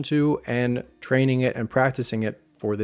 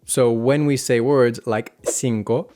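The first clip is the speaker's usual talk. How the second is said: ordinary talk, nothing marked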